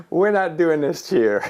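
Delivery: half-laughing, mock-firm no to the cheer; laughing refusal. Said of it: "I said".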